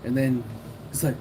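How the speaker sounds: plain reading voice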